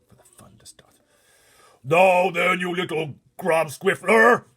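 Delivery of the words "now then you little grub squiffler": huge, slow, much louder and higher monster boom; booming giant. Acted. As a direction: boom it across the plain. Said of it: "boomed the flesh lump eater".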